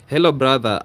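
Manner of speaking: usual speaking voice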